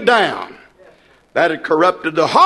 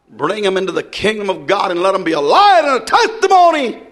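normal talking voice

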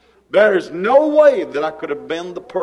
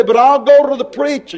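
desperate plea; 6 semitones higher, 4 dB louder, faster than usual. Retold or imitated. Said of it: "And you say".

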